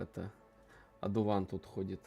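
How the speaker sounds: normal talking voice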